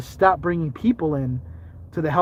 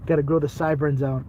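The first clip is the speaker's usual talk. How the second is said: usual speaking voice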